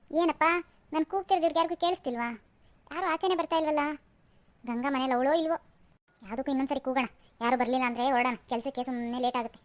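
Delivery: talking to herself. Acted. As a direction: wonder aloud quietly to yourself. Muttering to herself at the door. (ತನ್ನಷ್ಟಕ್ಕೆ ಮೆಲ್ಲಗೆ ಮಾತಾಡುವುದು)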